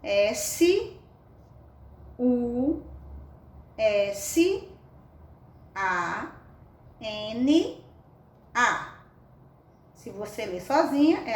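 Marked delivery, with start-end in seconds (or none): 0.0-8.9 spelling out with long pauses; name each letter separately; slow classroom spelling, one letter at a time. Shown as a demonstration. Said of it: "cada letrinha, cada uma vai ter um som".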